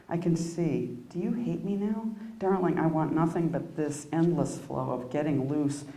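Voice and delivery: quiet, measured poem reading; poetry reading. Acted. Poet reading her dream poem to the audience, quiet and measured. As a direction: read it inward, letting lines fall away.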